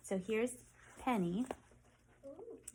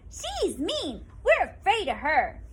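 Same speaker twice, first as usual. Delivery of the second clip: much higher, louder, small voice; frightened squirrel; 9 semitones higher, 11 dB louder, slower than usual. Acted. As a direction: squeal it as a scared squirrel. Squirrel fears Penny.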